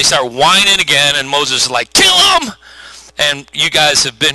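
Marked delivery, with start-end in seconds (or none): 1.9-2.5 very high-pitched mock outburst; hammed-up squawk of frustration; exasperated cry. Moses fed up with whining Israelites, exasperated cry.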